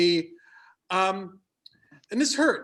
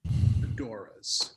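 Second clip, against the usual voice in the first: dramatic hush; 8 semitones lower, much slower than usual. Acted. Reading the fashion don't list.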